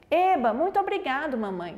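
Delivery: high cheer falling away at the end; lightly played happy kid; delighted child. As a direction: act a child happily thanking mum. Child thanking mother in acted dialogue.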